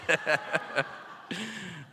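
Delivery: amused laugh, tailing off; easy falling chuckle. Real, not acted. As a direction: laugh at your own teasing. Laughs at teasing a colleague.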